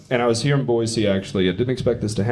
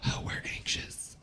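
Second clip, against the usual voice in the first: tiny whispered mock-timid reply; barely audible, timid imitation; anxious cat person; 15 dB quieter than usual. Audience member answers for cat people, meekly whispered.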